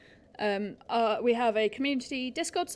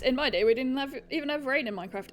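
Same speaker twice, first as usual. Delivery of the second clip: usual speaking voice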